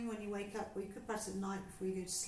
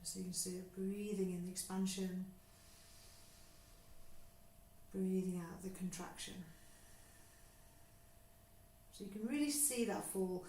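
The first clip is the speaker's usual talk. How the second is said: guided breathing; 3 dB quieter than usual. Acted. Teacher guiding a class through breathing, calm and unhurried. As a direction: talk them gently through each breath.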